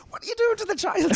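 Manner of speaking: high, loud shrieking mum; mock mum shout. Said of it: "my mum came out and was like".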